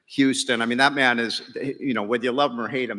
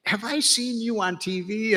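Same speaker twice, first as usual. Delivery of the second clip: higher, slow, puzzled woman's voice; curious woman; 9 semitones higher than usual. Retold or imitated. The woman quizzing him in the terminal, higher and slow. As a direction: slowly imitate her trying to place him. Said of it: "she kept looking up at me".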